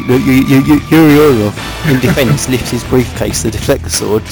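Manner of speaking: ordinary delivery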